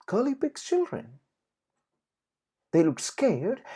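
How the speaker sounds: plain reading voice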